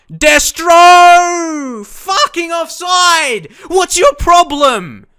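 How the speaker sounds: screamed high and loud, dragged out slowly; furious shout. Real, not acted. Gamer yelling at his striker for missing, furious. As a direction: roar your fury at the striker on screen. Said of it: "Sorry for getting frustrated".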